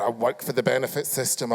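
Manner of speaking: "I work for the benefit system." said low and soft, sheepish; a touch of a sly confession; mocking the guest. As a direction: mimic a shifty guest owning up quietly. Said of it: "I think you cheat the benefit system".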